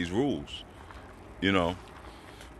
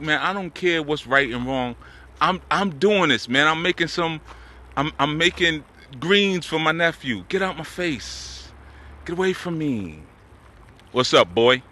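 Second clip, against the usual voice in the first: defiant elder; 7 semitones higher than usual. Retold or imitated. Voicing an elder's defiant attitude.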